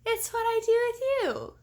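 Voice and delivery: put-on a small girl teasing her sister; little girl voice. A narrator's reading. Little girl answering Sabrina, in a high childlike voice. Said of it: "the little girl replied".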